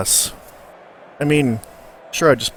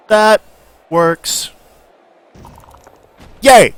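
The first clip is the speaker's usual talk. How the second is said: loud, high cheer; excited cheer; 10 semitones higher, 13 dB louder, much slower than usual. Real, not acted. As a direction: cheer it with real glee. Celebrating a block.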